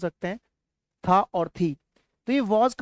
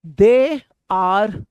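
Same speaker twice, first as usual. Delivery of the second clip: teacher's model take; 5 dB louder than usual. Shown as a demonstration. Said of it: "repeat करते रहिए".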